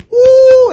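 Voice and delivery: high, loud whoop of a crowd; excited crowd woo; cheering whoop. Retold or imitated.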